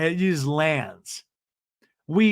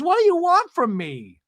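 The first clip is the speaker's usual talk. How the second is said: much higher, squeaky plea; mock exasperation; 14 semitones higher than usual. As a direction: throw up your hands in voice. Gina voiced, exasperated.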